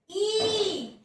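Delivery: ई drawn out with lips spread; smiling ई. Shown as a demonstration. Teacher showing pupils the lip shape, smiling ई. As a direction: hold ई through a slight smile. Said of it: "हल्का सा smile पास".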